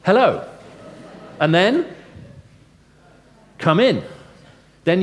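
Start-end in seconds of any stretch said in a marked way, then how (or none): 0.0-0.5 welcoming hello. Shown as a demonstration. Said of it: "You say". 3.6-4.0 welcoming host. Shown as a demonstration. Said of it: "visit routine in England".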